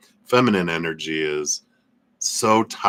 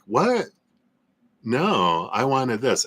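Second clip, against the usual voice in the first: mock surprise; 6 semitones higher, slower than usual. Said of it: "they're like".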